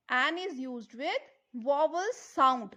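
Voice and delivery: slow, deliberate rule recitation; slow teaching drill. Shown as a demonstration. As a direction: spell the rule out word by word. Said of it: "I am repeating vowel sound".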